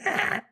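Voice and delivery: short high creature noise; raptor snarl. Retold or imitated. Raptor sneaking up on the man, imitated snarl. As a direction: imitate a raptor's rasping cry.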